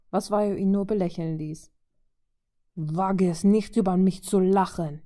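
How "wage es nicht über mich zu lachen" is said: menacing growl. Acted. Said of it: "raunte er bedrohlich".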